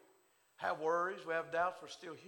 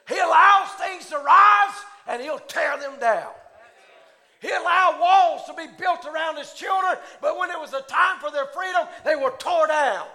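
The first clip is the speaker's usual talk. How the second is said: preaching at full force; 7 semitones higher, 14 dB louder than usual. Acted. Preacher proclaiming Daniel's blessing to the congregation, shouting. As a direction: thunder the words of Daniel to the church.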